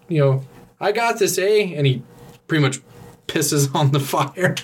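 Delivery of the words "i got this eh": put-on higher, breezy Canadian accent. Rick Moranis facing the fire in a Canadian accent. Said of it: "he's like".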